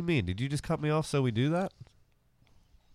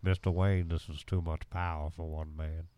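Morgan Freeman; 6 semitones lower, 7 dB quieter than usual. Retold or imitated. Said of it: "Morgan Freeman".

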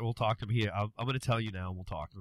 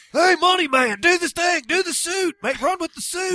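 much higher, louder excited fan yelling; fans calling out; 10 dB louder than usual. Retold or imitated. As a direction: holler at him like a stranger on the street. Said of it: "I get people coming up".

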